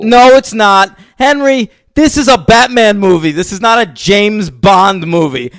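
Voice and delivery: loud, high indignant rant; exasperated protest. Real, not acted. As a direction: argue back hotly at your co-host. Heated quarrel about the film.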